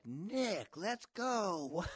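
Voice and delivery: high, loud holler to a friend; high-pitched shout; calling out. Retold or imitated. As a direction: yell to hurry him along from a distance. Acted calling out to hurry friend.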